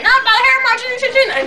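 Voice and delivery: very high cartoon pig refusal; mock pig voice. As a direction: squeal it as a storybook pig. Pigs refuse wolf at door.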